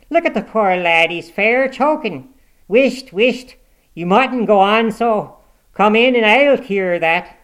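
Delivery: loud, high housewife in dialect; indignant, strident fussing over the victim; scolding tirade. A narrator's reading. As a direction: scold at full volume with slow, stretched phrases. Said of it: "turned her vocal artillery against".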